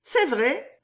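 lightly lifted agreement; Riquette. Acted.

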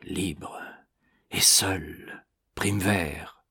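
soft, broken murmur with pauses between words. A narrator's reading. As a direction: murmur it low to oneself. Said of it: "Puis il murmura". (murmuré tout bas, pour soi-même)

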